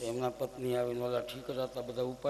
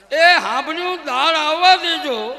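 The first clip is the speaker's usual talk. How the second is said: much higher and louder, slow call; husband yelling toward the kitchen for dal; 12 semitones higher, 18 dB louder than usual. Acted. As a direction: shout it to reach the kitchen. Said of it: "અવાજ થોડોક વધાર".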